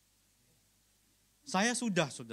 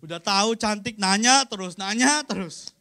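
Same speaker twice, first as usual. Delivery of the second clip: irritated husband's brush-off, louder; annoyed reply; 3 semitones higher, 7 dB louder than usual. Acted. Husband brushing off his wife, annoyed and louder. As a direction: snap back as if tired of the question. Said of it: "Jangan kita jawabnya gini".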